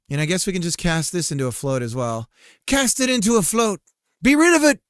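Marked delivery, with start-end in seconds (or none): 2.7-4.8 high, loud hammed-up order; mock command. Coder ordering a number cast to float, mock-dramatic. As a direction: proclaim it, building to a louder finish.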